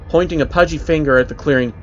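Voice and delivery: usual speaking voice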